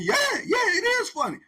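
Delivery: very high, loud jeering yell; mocking shout. Inmate jeering back at the other, loud and very high. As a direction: jeer back at him loudly. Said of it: "He said".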